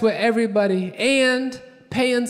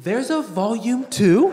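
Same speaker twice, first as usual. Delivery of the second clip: incredulous question, slowed and rising at the end; comic astonished query; mock amazement. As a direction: draw out the disbelief, lift the ending. Mock amazement that a sequel exists.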